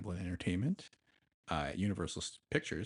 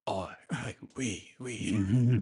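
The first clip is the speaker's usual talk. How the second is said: imitating French spoken with a Cockney accent; lightly put-on Cockney mouthing French words; much slower than usual. Imitating Bob Hoskins speaking French, in a Cockney accent. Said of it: "like, Cockney accent".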